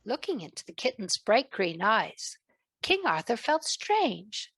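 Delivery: plain reading voice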